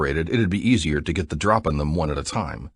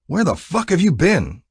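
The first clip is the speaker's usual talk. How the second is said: higher, pushy challenge; demanding; 12 semitones higher, 4 dB louder than usual. A narrator's reading. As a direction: press him hard for an answer. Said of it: "Zane demanded".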